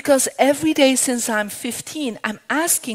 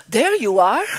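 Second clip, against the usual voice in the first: surprised recognition; 8 dB louder than usual. Retold or imitated.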